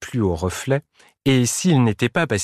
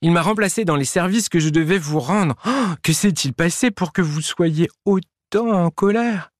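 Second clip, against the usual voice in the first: hesitant hum, then higher-pitched explaining; slightly embarrassed; 7 semitones higher than usual. A narrator's reading. Prosper explains his reflection to angry friends, embarrassed. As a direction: explain yourself awkwardly, voice raised. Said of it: "d'une voix un peu gênée".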